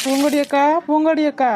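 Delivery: loud, urgent calls to wake her; shouting to rouse a fainted woman; calling out anxiously. Acted.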